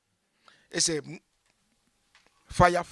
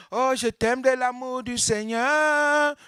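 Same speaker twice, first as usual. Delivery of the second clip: mock piety; 7 semitones higher, slower than usual. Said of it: "Non, non".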